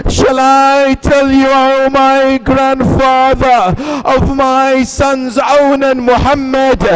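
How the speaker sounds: loud, slow wailing lament to the Prophet; Zainab's lament. Acted. Zainab pleads at the Prophet's grave, loud wailing lament. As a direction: cry it out as the grieving sister, drawn out.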